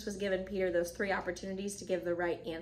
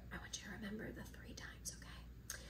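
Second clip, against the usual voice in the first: conspiratorial whisper; whispered aside; 19 dB quieter than usual. Real, not acted. Preacher asks listeners to remember a detail, whispering. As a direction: drop to a whisper, as if confiding.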